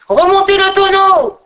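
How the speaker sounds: high, loud sustained call. A narrator's reading. Jean de l'Ours calls up the well, high sustained shout. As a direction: holler it up, stretched out high. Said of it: "puis cria vers le haut du puits".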